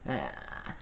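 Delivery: very low, strained croak of a worn-out voice; lost voice. Retold or imitated. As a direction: croak it like your voice is shot. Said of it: "I'm over here sounding like".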